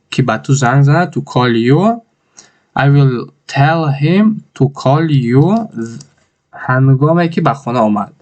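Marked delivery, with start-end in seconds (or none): 2.8-5.6 very slow and drawn out; slow model sentence. Shown as a demonstration. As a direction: repeat it slowly as a model. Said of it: "teacher repeats slowly". (خیلی آهسته و کشیده)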